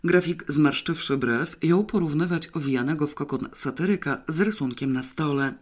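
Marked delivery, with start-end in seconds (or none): none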